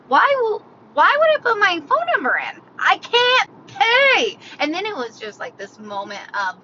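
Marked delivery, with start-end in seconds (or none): 0.1-4.4 baffled exasperation. Retold or imitated. Walletless shopper baffled by the cashier's question, high exasperated protest. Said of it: "I'm just so confused".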